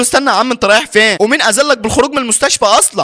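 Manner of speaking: high-pitched, insistent questioning; indignant challenge. Acted. Doctor stopping patient who leaves unpermitted, indignant.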